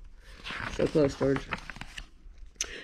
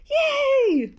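a high excited yay; cheering. Acted. George cheering after barking, excited yay. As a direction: cheer like a happy pup.

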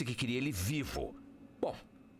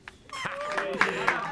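pleased laugh; 6 dB louder than usual. Acted. Pilot celebrated after mission.

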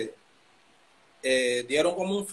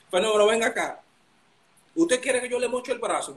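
high, louder mock threat; a boss half-joking, pitched up; 7 semitones higher, 6 dB louder than usual. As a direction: playfully threaten him.

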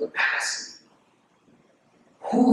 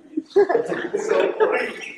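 mocking laugh; 13 semitones higher than usual. Real, not acted. Mocking teachers' praise.

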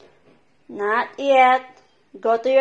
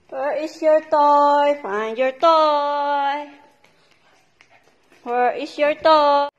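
ordinary talk, nothing marked

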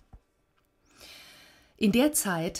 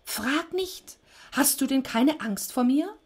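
high, exasperated creature voice; increasingly agitated Death; 7 semitones higher than usual. A narrator's reading. Death wants Sisyphos to be afraid, agitated. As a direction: demand fear in a high voice. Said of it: "Thanatos Stimme wurde immer lauter".

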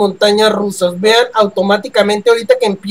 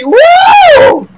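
excited wow; 7 dB louder than usual. Real, not acted. Hyped reaction.

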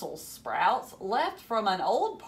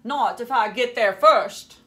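loud, racing challenge; French Toast yelling a dare; eager shout; 3 semitones higher, 11 dB louder than usual. A narrator's reading. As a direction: call it out like you're already running. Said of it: "French Toast replied".

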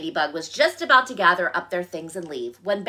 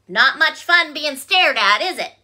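higher, louder little frog; 7 semitones higher, 6 dB louder than usual. A narrator's reading. Frog to staring bear, knowing and pointed.

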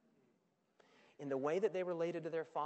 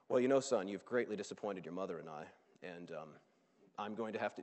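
lightly voiced stiff dad, lower and businesslike; a hint of a cold father reading a proposal; deadpan father voice; 9 semitones lower than usual. Preacher voicing the father as a cold businessman. Father answering the returning son.

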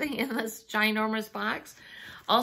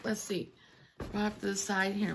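ordinary delivery

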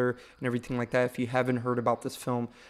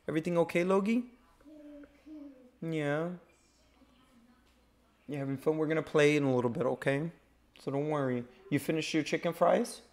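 higher, gentle parent voice; 4 semitones higher, 3 dB quieter than usual. Real, not acted. Father checks on young son.

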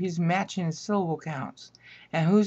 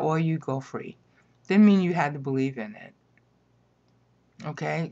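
ordinary talk, nothing marked

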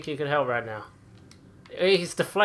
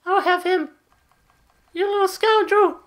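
much higher, louder put-on voice; high squeaky character voice; 13 semitones higher, 6 dB louder than usual. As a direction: squeal it like a cartoon villain.

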